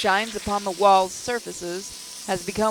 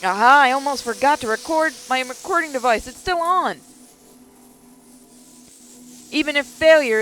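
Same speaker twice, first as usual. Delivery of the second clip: panicked; 5 semitones higher, 5 dB louder than usual. Acted. Hurried panicked hiding.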